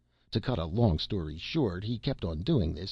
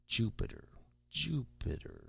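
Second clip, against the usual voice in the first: softly turning the name over, pitch lifted; musing recollection; 4 semitones higher, 7 dB quieter than usual. A narrator's reading. Clerk trying to recall the planet, musing softly. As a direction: murmur it while searching your memory.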